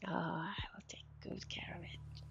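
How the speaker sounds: soft menacing whisper. Pretend. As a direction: promise it like a villain, hushed. Sinister game line read aloud.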